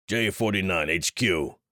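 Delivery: put-on angry call into the radio, Finnish accent thickening; growled. Jonas radioing headquarters in a mild, contained growl. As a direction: growl the call sign, barely holding the fury. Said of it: "he growled into the mic".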